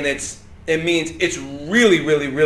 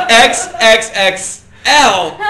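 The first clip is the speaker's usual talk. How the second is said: laughing; 5 semitones higher, 6 dB louder, much slower than usual. Acted. Laughing joke about baggy sizes.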